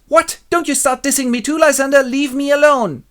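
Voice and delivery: high, loud mock outrage; indignant Helena. Helena rejecting enchanted Lysander, indignant. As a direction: shriek a high, offended telling-off. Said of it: "And she goes".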